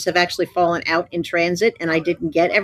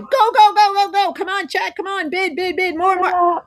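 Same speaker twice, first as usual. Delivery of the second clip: yelling; 10 semitones higher than usual. Retold or imitated. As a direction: hurry them on with a yell. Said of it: "yell at them going".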